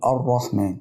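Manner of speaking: "الرحمن" as ar-rahman, opening on a; fatha at the start. Shown as a demonstration. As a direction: begin "الرحمن" with an open a. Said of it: "فتح الحرف بيكون ازاي؟".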